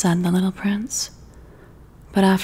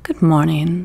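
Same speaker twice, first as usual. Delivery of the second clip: lightly voiced little prince, polite; a touch of a courteous young prince; polite greeting; 2 semitones lower, 7 dB louder than usual. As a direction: prince politely answering the fox. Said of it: "the little prince responded politely".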